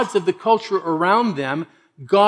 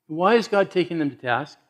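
ordinary talk

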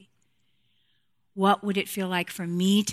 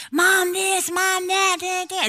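put-on nagging kids; 12 semitones higher, 6 dB louder, slower than usual. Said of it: "ladies, I get it".

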